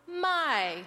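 "my" from high, falling. Shown as a demonstration. Cueing group four with the Thai falling tone. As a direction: let "my" fall from the top. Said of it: "also do the falling tone".

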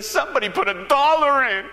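loud, high mock bawling; tearful man. Man upset over bigger gift, loud imitated wail. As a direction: bawl it out as the distressed man. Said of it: "he was just a-crying".

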